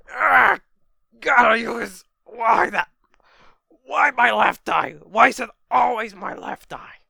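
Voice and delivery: loud, high, aggrieved cry of pain; acted wail of a wounded villain; pained yelling. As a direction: yell in agony and outrage. Villain hit in his bad eye.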